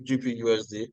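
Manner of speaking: usual speaking voice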